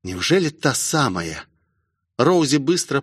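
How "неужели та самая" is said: usual speaking voice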